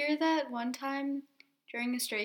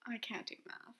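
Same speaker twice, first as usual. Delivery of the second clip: whisper; 11 dB quieter than usual. Real, not acted. Whispered aside while calculating.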